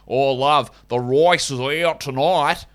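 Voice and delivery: exaggerated, drawn-out fake Australian; bad Australian accent. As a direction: ham up a terrible Australian accent. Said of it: "imitating an Australian accent".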